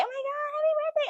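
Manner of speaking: very high mock-excited squeal; birthday well-wishers. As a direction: imitate gushing callers with birthday wishes. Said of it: "wishing me a happy birthday".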